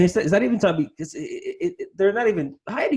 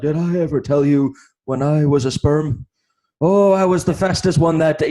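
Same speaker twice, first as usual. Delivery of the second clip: louder, higher goofy boast; mock Jim Carrey bit; 3 semitones higher, 7 dB louder than usual. Retelling a comedian's bit, goofy louder boast. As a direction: ham up a comic's smug brag. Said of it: "And he's like".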